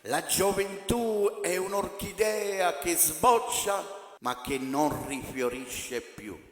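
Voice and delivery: ordinary delivery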